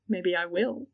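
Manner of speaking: a touch of playful mischief; cheeky. Acted. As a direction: say it with a cheeky smile. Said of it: "said Demelza with a cheeky smile".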